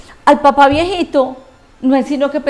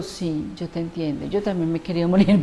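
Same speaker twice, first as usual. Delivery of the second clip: much softer, lower, reassuring reply; gentle reassurance; 6 semitones lower, 7 dB quieter than usual. Retold or imitated. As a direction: comfort them quietly as if leaning in.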